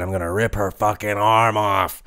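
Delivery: lightly voiced angry wrestler threat; a hint of a furious wrestler; uncensored threat. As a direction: give it her real words with a little bite. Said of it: "She said".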